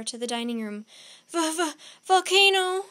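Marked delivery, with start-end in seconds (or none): 1.3-2.9 loud, high stammer on the first sound; stuttering with fright, then bursting out; panicked stutter. A narrator's reading. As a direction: stammer it out in alarm. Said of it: "stuttered Jack".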